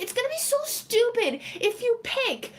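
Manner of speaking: ordinary talk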